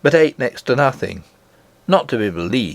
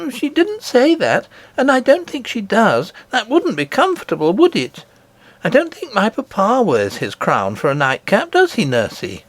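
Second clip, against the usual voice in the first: put-on an eager child telling a wonder; little princess; 10 semitones higher than usual. Princess telling nurse of her grandmother, high and eager.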